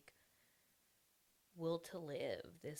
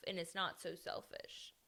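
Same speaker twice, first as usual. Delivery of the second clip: usual speaking voice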